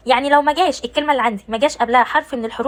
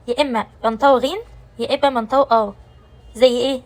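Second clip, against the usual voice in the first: ordinary delivery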